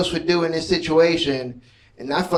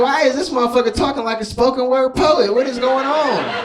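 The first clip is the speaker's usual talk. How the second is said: higher, baffled disbelief; mock bafflement at the intruder; incredulous; 8 semitones higher than usual. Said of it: "I was like".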